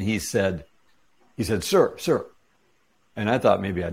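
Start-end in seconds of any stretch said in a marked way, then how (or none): 1.6-2.3 mock call across the store, sharply higher; imitating a stranger calling out sir; calling after someone walking away.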